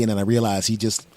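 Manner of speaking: ordinary talk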